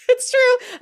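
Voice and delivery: bursts out laughing, high and loud. Real, not acted. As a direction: laugh it out big. Laughing at own jury story.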